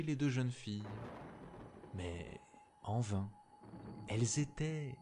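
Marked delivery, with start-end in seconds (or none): none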